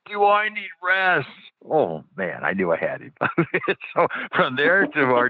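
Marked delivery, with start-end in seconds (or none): 0.0-1.3 high, slow mock yell of the driver; retold yell. Taxi driver startled by the promise of rest, high slow mock yell. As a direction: replay his stunned shout. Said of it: "he yelled".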